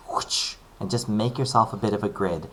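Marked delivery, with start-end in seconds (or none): 0.1-0.6 soft unvoiced whoosh of a ruler stroke; a breathy ruler-swipe noise; ruler swoosh. Shown as a demonstration. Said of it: "just go whoosh".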